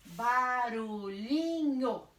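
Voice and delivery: "Barulhinho" slow, every syllable drawn out and joined; stretched syllables. Shown as a demonstration. Teacher reads back the divided word, "Barulhinho" in stretched syllables. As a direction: stretch "Barulhinho" through without pauses. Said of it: "eu vou separar".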